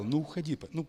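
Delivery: ordinary talk, nothing marked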